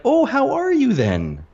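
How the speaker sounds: very high, drawn-out "oh"; exaggerated, high-pitched warm-up to a Canadian; mock delighted greeting. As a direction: gush in a high put-on voice at a welcome guest. Locals' delight at learning he's Canadian.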